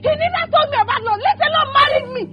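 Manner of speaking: sobbing, shouting accusations through tears; tearful furious shout; crying angry outburst. Acted. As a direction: rage and grief spilling out together.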